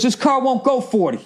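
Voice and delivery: high, odd put-on voice of the driver; mock the smug driver's excuse; snooty driver. Rude driver voiced in story.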